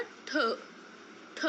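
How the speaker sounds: ठ retroflex, aspirated, said twice; sounding out ठ. Shown as a demonstration. Teacher repeating ठ for learners. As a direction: hit ठ crisply twice. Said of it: "तो उसका क्या बनेगा".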